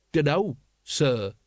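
slow grudging respect; dragging out a cowed polite answer; corrected politely. A narrator's reading. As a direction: add the sir slowly and carefully. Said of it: "he corrected".

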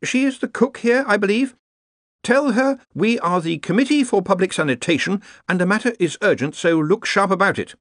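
high-pitched, urgent official; officious Ponder. A narrator's reading. Ponder orders the scullery boy to hurry, officious. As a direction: boss the listener about in a high voice.

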